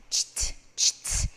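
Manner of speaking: "cht, cht" as pure air; no vocal cords. Shown as a demonstration. Teacher demonstrating a sound without vocal cords, voiceless "cht". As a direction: whisper "cht" sharply, twice. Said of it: "목 성대를 쓰지 않는 사운드".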